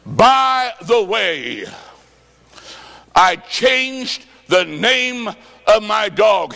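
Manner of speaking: drawn out, each word slowly weighted; slow dramatic reveal. Retold or imitated. Preacher slowly voicing the woman's punchline to the congregation. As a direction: stretch it out to land the punchline.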